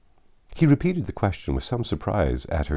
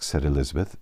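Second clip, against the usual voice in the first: usual speaking voice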